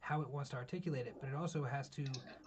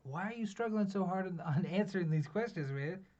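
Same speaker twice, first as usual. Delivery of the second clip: higher, louder mock-exasperated questioning; exasperated challenge; 5 semitones higher, 6 dB louder than usual. User asking the AI why it struggles, exasperated. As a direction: challenge it in raised, incredulous pitch.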